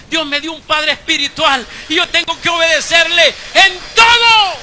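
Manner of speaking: builds to a loud, impassioned declaration; a pledge of obedience pushed out loud; rising fervent vow. Retold or imitated. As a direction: swell the voice as the promise grows. Vows obedience, rising emotion.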